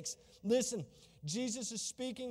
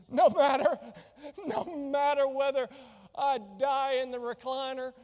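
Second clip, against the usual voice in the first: loud, fervent preaching cry; a full-voiced preacher's shout; 6 dB louder than usual. Real, not acted. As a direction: belt it out to the congregation. Preacher building to climax.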